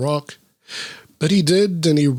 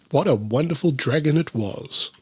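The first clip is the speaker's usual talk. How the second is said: plain reading voice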